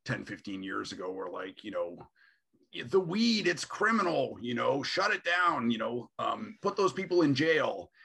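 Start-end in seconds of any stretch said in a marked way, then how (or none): 2.8-7.9 mock moral outrage, pitched up and louder; hammed-up indignant crusader demanding a crackdown; outraged conservatives.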